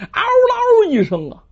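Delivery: long high howl of pain; monster howl. A narrator's reading. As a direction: howl like a dying demon. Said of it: "那叫声太恐怖了". (妖人惨叫)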